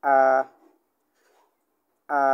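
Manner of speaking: "আ" held level and steady, Mandarin first tone; first tone demo. Shown as a demonstration. Teacher demonstrating first tone to students, level "আ". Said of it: "উচ্চারণ হচ্ছে এরকমের".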